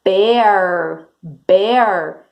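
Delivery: ordinary talk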